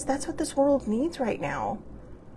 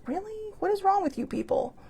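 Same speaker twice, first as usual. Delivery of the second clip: incredulous; 11 semitones higher than usual. Retold or imitated.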